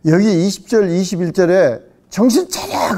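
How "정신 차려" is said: high-pitched rebuking cry; mock shout at a wayward listener; acted scolding shout. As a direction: cry it out high like a wake-up call. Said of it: "소리 지르는 거야".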